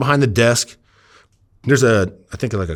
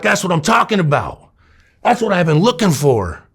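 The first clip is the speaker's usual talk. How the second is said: excited; 9 semitones higher, 3 dB louder than usual. Acted. Hyping the dope to dealers.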